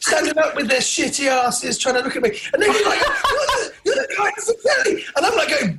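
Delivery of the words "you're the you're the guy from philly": high laughing fan voice; imitating an excited fan. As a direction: gush like an overexcited fan. Fan recognising him, retold.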